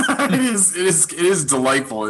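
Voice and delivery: laughing. Real, not acted. Laughing at the comic.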